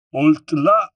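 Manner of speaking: high, loud French sergeant's command; a guard stopping strangers at the gate; barked French order. A narrator's reading. French sergeant halting the marching men, loud order. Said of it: "ordered a sergeant commander".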